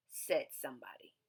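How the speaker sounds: ordinary delivery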